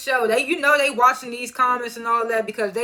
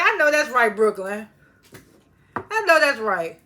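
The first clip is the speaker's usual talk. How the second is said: cheering agreement; 4 dB louder than usual. Real, not acted. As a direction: hype her up, louder. Cheering Brooklyn's demand.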